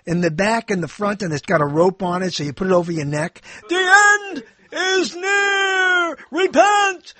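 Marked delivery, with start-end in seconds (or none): 3.7-7.1 high, slow street-preacher doom cry; alarmist doom warning. Retold or imitated. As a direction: wail it like a sign-carrying prophet. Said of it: "They got signs".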